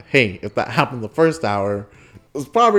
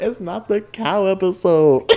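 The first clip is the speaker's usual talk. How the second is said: put-on slow, high childish wail; mock crying; 8 semitones higher, 4 dB louder, slower than usual. Child explaining to his mom over and over, mock crying. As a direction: imitate a kid bawling, stretched out.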